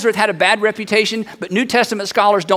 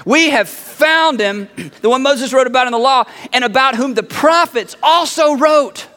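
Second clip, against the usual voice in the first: excited proclamation; 4 semitones higher than usual. Acted. Philip announces the Messiah to Nathanael, excited. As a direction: proclaim it with great conviction. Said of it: "he's so excited about this".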